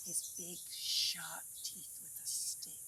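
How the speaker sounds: slow, breathy whispered narration. A narrator's reading. Narrator whispers about the crocodile picking his teeth, slowly.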